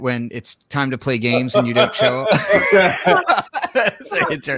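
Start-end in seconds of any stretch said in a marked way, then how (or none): 1.9-4.6 teasing and breaking into laughter; laughing. Real, not acted. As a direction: laugh at the end of the joke. Teasing inside joke, laughs.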